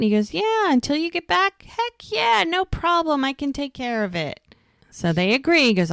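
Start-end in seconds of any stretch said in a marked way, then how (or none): 0.3-4.4 high-pitched, eager acted reassurance; eager agreement. Friend agreeing to cover at the bar, eager.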